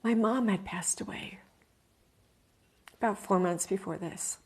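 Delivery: hushed, near-whispered grief rising; emotion welling up. Real, not acted. Telling listeners of her mom's death, choked up. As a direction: let the words catch as grief comes up. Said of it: "Emotion coming up".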